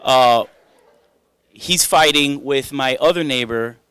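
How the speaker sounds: usual speaking voice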